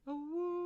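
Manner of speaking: long high wolf howl, full voice. Acted. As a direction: throw your head back and howl. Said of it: "and lets out a mighty howl".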